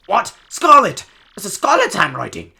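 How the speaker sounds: loud, high, astonished cry; shocked outburst. Acted. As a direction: exclaim in hammed-up shock. Sailor shocked by wife's letter.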